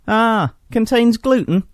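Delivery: a hint of a fussy clerk checking a list; slow inquiring assistant. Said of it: "he inquired".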